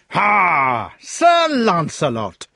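loud, slow gloating shout; triumphant shout. Acted. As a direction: bellow in triumph. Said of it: "shouted the fat knight".

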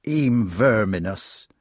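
plain reading voice

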